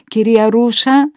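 loud, high questioning call. A narrator's reading. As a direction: call out for the carer in the night. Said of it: "να μην φωνάξει τη γυναίκα". (δυνατό κάλεσμα)

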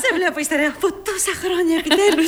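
put-on high, laughing greeting to an old friend; laughing welcome. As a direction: greet her through delighted laughter. Υποδέχεται την παλιά φίλη. (γελαστό καλωσόρισμα)

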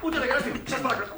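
high, agitated protest; exasperated scolding. Acted. Director orders a laughing actress to sit down, exasperated.

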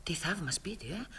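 soft whisper behind her hand; whispered aside. Acted. Elena to her husband about the grand house, a soft whisper. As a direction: breathe it to her husband so Sofia won't hear.

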